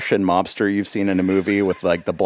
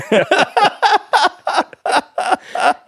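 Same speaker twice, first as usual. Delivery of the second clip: high laugh; 3 dB louder than usual. Real, not acted. Laughing at untuned piano.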